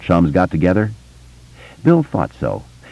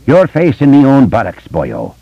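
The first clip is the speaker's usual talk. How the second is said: loud, high Irish cop brogue; 8 semitones higher, 4 dB louder than usual. A narrator's reading. Richie mocks Bill in his Irish cop brogue. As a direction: bark it like an Irish beat cop. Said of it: "Richie said in the Irish cop's voice".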